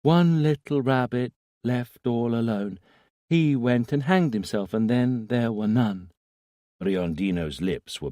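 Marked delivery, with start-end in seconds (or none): 0.0-6.1 put-on high, small child reciting a rhyme; sing-song nursery rhyme, childlike; little boy voice. A narrator's reading. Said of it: "small, infantile voice".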